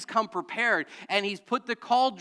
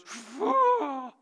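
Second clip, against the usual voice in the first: slow drawn-out blowing poof; wolf blowing; 7 semitones higher than usual. Acted. The wolf blowing down the straw house, a long breathy poof. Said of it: "He goes".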